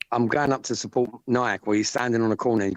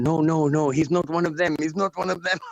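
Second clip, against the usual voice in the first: higher-pitched pleading-off impression; imitated urgent objection; 7 semitones higher than usual. Retelling how one man vouched for him, high insistent imitation. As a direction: mimic someone insisting on a correction.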